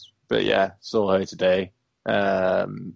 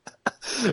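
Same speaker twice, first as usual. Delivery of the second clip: a sudden high squeal of laughter; high burst of laughter; 5 dB quieter than usual. Real, not acted. Friend squeals with laughter at the punchline.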